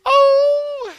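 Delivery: high, loud squeal of delight; a shrieking, laughing "Oh!" from the drive-through girl; drive-through girl squeals as a lamb baas at her. Retold or imitated. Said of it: "And she goes".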